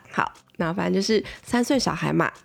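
ordinary delivery

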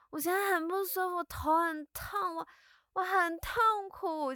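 high, slow suffering moan; whiny pained plea. Retold or imitated. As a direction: play up the pitiful victim. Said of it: "用这种，我现在很痛苦，我很难受的方式".

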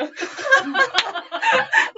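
high burst of laughter at herself. Real, not acted. Laughing at her own blunder. (尴尬地大笑)

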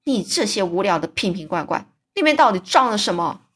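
usual speaking voice

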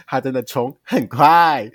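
slow, loud, exaggerated salesy emphasis; bratty sales pitch. Sister overselling the fast charger to her brother, hammed up. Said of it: "我就用这个语调跟我弟讲话".

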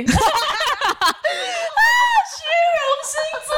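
blurted out through laughter, high and fast; laughing. Real, not acted. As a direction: laugh while you say it. Laughing at her own vanity.

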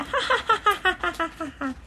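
high, bright run of laughter; gleeful laughter. Acted. Mr. Happy laughing with glee at his clever plan. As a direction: laugh at your own clever idea. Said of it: "不由得笑了起来".

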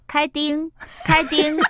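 loud, high attempt at Mandarin with a Fujian accent; comic Fujian dad straining at Mandarin. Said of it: "你要讲普通话".

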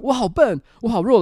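loud self-scolding shout; retold yell. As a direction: shout it like at the crossing. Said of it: "我就在我喊完". (重现大吼自责)

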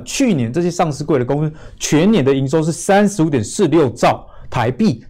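ordinary talk

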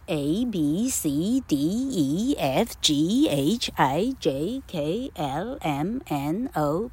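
"A, B, C" with each letter stretched and swung upward; overdone singsong "A, B, C" through to "Z", letters drawn out; exaggerated alphabet recitation. Shown as a demonstration. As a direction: recite A to Z letter by letter in a repeating rising lilt. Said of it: "老客这样有没有夸张到".

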